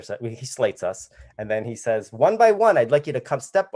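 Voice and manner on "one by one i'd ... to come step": higher, lightly voiced director giving instructions; raised-pitch retold announcement; casting director. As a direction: call the group forward like a casting director. Retold casting director addressing auditioners.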